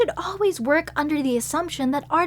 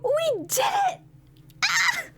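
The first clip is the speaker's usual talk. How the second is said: tearful joy; 12 semitones higher, slower than usual. Acted. Finds the treasure.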